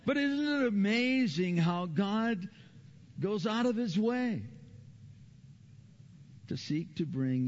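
high, loud, slow wonder; awed preaching. Real, not acted. Preacher marveling to the congregation, slow and loud. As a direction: marvel at it slowly.

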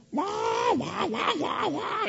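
very high squawking seagull cries; Scuttle the seagull. Retold or imitated. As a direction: screech like a cartoon gull. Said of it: "sound like Scuttle from The Little Mermaid".

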